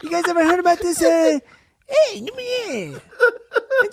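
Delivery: high-pitched, laughing, cracking mock Leno voice; Jay Leno impression. Host attempts Jay Leno on request, laughing through it. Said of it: "Jay Leno".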